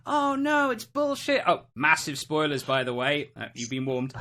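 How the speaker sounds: normal talking voice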